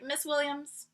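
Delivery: "Miss Williams" said quietly, at normal pace. Retold or imitated. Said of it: "I was like".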